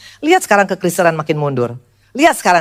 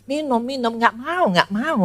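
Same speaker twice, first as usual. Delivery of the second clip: whiny refusal; 6 semitones higher, 4 dB quieter, slower than usual. Retold or imitated. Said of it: "aku bilang begitu".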